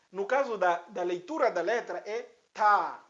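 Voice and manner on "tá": "tá" with a long, drawn-out vowel; lengthened tá. Shown as a demonstration. Teacher shows pupils the letter's reading, lengthened "tá". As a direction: hold the "a" of "tá". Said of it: "Alongamos um pouco".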